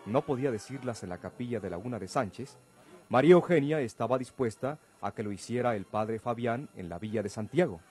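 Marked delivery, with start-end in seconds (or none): none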